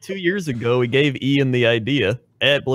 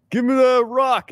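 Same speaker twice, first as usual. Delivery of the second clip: much higher, slow ogre demand; dim giant; 12 semitones higher than usual. Acted. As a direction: grab the rock like a dumb ogre. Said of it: "do your ogre voice".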